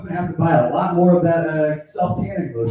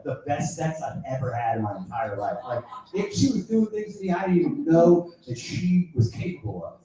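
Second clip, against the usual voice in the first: usual speaking voice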